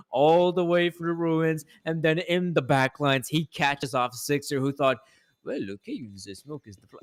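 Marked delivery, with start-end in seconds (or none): 5.4-7.0 mock outrageous French accent; lightly put-on French accent, softer. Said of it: "that outrageous French accent".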